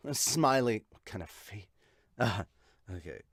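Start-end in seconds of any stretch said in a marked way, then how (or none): none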